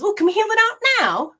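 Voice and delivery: the soul asking, pitched high; a hopeful, high little voice for the soul; soul's voice. Acted. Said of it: "your soul says".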